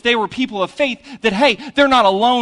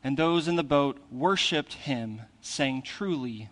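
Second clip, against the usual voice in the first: scripture reading; 7 semitones lower, 8 dB quieter than usual. Acted. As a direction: read the gospel gently to the congregation. Reads the scripture passage.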